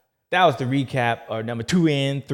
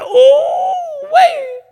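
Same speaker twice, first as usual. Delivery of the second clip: much higher, loud, crying-out shriek; 12 dB louder than usual. Retold or imitated. Watching the boy get beaten, a loud shocked shriek. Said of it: "I was like".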